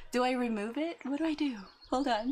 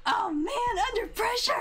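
flustered laugh; 13 semitones higher than usual. Real, not acted. Streamer flustered by her frozen camera, laughing.

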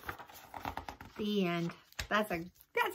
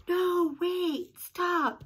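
higher, louder pleading frog; croaked plea; 10 semitones higher, 4 dB louder, much slower than usual. Acted. Froggy begging the party not to end, pleading. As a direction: beg them to stop like a little frog. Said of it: "Froggy croaked".

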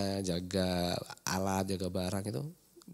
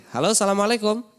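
high, loud, cheerful hello to the caller; bright on-air greeting; 12 semitones higher, 11 dB louder than usual. Real, not acted. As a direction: greet the caller with a big lift. (sapaan ceria penyiar)

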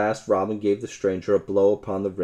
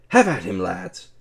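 rallying cry; 5 dB louder than usual. A narrator's reading. Will Stutely urges his yeomen to attack, loud rallying cry. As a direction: urge the men to charge. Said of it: "cried Will Stutely".

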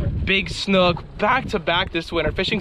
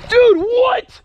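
high, loud stunned cry; astonished shout; 8 dB louder than usual. Real, not acted. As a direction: blurt it out in shock. Huge gar spotted.

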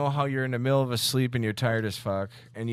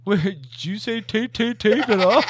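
higher, slower mimicry of "tape, tape, tape"; mock the stammer on "tape", pitched up; teasing echo of someone's stutter; 5 semitones higher than usual. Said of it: "So he's like".

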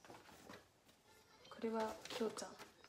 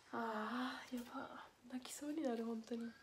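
softer, whispered, fighting back tears; tearful whisper; 9 dB quieter than usual. Real, not acted.

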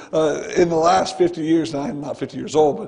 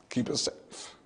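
hushed whisper to God on the drive; whispered prayer; 4 semitones lower, 13 dB quieter, slower than usual. Retold or imitated. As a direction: whisper it, much softer. Desperate prayer while driving.

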